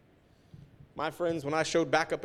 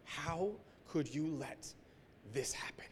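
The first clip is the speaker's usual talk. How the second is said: soft, slow, almost whispered plea; hushed retold cry to God; 9 dB quieter than usual. Hushed slow plea to God. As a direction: pastor whispering his anguish at his father God. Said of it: "And just yelling at my father".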